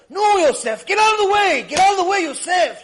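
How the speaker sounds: high, loud frantic warning; upset shouting. Retold or imitated. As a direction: imitate an old woman yelling at the story's hero. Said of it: "She's getting upset".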